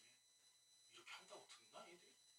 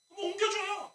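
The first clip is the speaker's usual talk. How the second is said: whiny request; 14 semitones higher, 4 dB louder than usual. Retold or imitated. Whining member mimicked.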